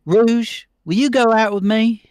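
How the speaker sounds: high, loud Southern belle voice. Acted. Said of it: "I made Rouge a Southern Belle".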